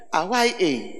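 normal talking voice

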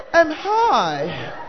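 drawn-out ums in a put-on voice; mock a nervous pretender introducing himself; halting, affected self-introduction; affected, hesitant suitor. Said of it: "even the M, M that you are saying".

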